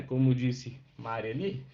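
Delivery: normal talking voice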